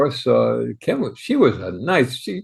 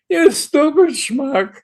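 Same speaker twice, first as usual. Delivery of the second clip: retold furious scream; 12 semitones higher than usual. Studio boss screaming at the young errand boy, high mock rage. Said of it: "he started screaming at me".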